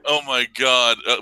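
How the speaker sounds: normal talking voice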